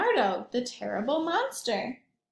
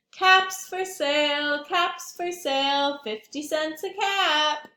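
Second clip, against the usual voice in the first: slow, louder street-seller call; peddler calling out; 3 semitones higher, 3 dB louder than usual. Acted. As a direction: cry your wares like a tired peddler. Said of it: "slowly he walked back to town calling".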